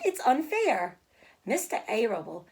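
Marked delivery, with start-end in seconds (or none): 0.0-1.0 pleading cry. Acted. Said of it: "she sobbed".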